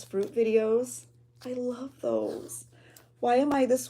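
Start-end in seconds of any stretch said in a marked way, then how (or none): 1.4-2.6 slow, hushed confession; whisper. Real, not acted. Vlogger confessing to viewers she loves glass fruit videos, whispered. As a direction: whisper it like a guilty secret.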